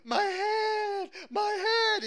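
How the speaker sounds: high, wailing cry of panic; acted hysterical child crying out in pain; hysterical screaming. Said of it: "I'm, like, I'm hysterical".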